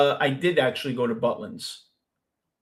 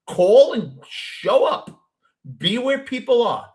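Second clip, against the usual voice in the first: slow, higher, emphatic advice; firm advice to a friend; 8 semitones higher, 4 dB louder, slower than usual. Retold or imitated. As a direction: spell it out slowly for Mitch. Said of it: "I tell my friend Mitch".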